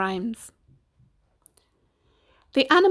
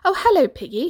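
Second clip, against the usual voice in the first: higher, louder ladylike greeting; 7 semitones higher, 5 dB louder, faster than usual. Acted. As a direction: lift the pitch in pleased recognition.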